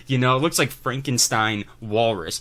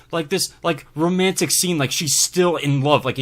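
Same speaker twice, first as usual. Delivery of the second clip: ordinary talk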